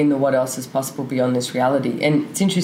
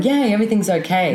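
mock bright forced "yay", a bit higher; fake cheer; 6 semitones higher than usual. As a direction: put on a hollow happy face voice. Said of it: "like putting the smile and going".

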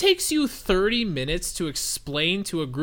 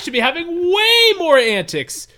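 higher, louder, gleeful self-quote; excited realization; 7 semitones higher, 4 dB louder than usual. Retold or imitated. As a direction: play it as a sudden giddy epiphany. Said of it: "I was like".

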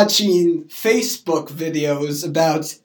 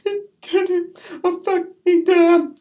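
high, slow, whimpered mock protest; hurt denial; 13 semitones higher, slower than usual. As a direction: squeeze it out high as if upset. Acted hurt denial to parent.

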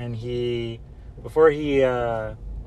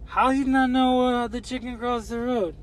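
much higher, incredulous grandfather voice; mock disbelief; 12 semitones higher than usual. Grandfather incredulous at grandchild not knowing, high mock disbelief. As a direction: act amazed the kid doesn't know.

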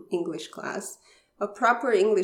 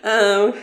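loud laugh; 3 semitones higher, 12 dB louder than usual. Real, not acted. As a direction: let a real laugh take over. Laughs at own memory.

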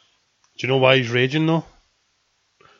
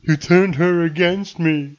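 whiny emo Vader; 5 semitones higher, 6 dB louder, slower than usual. Acted. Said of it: "mocking Vader as emo".

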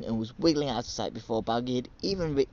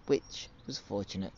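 plain reading voice

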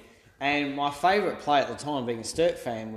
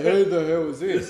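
gruff phone answer; 3 semitones higher, 6 dB louder than usual. Retold or imitated. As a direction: bark it like a cranky stranger. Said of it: "He was probably saying that voice too".

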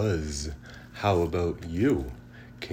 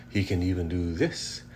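ordinary delivery, nothing marked

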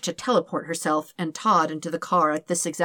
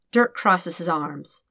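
ordinary delivery, nothing marked

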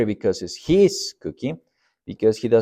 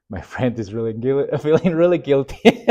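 breaking up laughing mid-sentence; 3 semitones higher than usual. Real, not acted. Pastor recalls guilty friend after the pizza prank, laughing as he talks. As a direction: tell it through helpless laughter. Laughs while retelling the story.

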